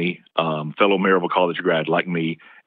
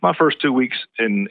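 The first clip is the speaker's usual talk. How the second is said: ordinary delivery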